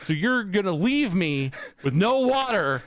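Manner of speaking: high, loud, incredulous retort; livid. Retold or imitated. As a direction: build to a near-shout, punching each word. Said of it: "I'm livid at this point".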